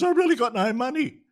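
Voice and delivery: high, loud pleading yelp; mock screaming. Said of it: "he started yelling and screaming and yelling".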